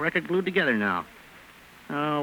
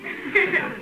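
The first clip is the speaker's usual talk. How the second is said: nervous laugh; 9 semitones higher than usual. Acted. Nervous laugh when caught.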